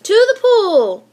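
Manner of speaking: high, loud, slow shout; yelled. A narrator's reading. Firemen shouting orders at the pool, high loud yell. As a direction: bellow it out. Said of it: "yelled the firemen".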